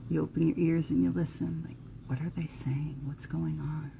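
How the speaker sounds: very soft, as if straining to overhear; whispering. Acted. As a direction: drop into a hushed, secretive whisper. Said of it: "when people start to whisper and you hear whispers".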